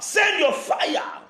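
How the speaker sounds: very high, drawn-out cry; piercingly high, slow prayer shout. Real, not acted. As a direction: cry out to heaven, stretching each word. Prayer call for divine fire.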